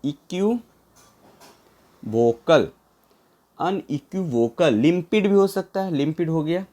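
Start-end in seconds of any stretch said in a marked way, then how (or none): 0.0-2.7 equivocal very slow, a long pause before vocal; broken up slowly. Shown as a demonstration. Teacher helping learners remember, equivocal slowly in two parts. Teacher breaks word for memorizing.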